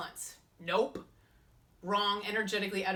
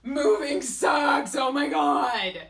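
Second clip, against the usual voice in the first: drawn-out, much louder complaining wail; whiny shout; 8 semitones higher, 11 dB louder, much slower than usual. Acted. Vlogger wails to viewers about her move, slow loud whine. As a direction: wail it slowly like a fed-up mover. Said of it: "What is my attitude about my move?".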